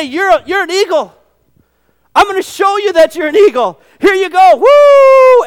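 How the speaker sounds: loud, high cheering mother voice; encouraging mother eagle. Retold or imitated. Preacher voicing the mother eagle throwing her chick, cheering. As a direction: push the chick out with glee.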